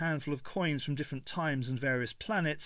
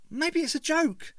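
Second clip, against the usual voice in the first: put-on much higher, slowish hopeful girl's voice; Ace cheering him up; 4 dB louder than usual. A narrator's reading. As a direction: try to lift his spirits. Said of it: "attempting to cheer him up".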